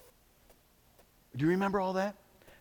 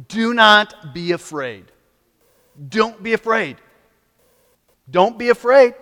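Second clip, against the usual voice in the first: building exhortation; 6 semitones higher, 9 dB louder than usual. Acted. Preacher exhorts the congregation.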